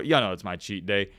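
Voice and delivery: normal talking voice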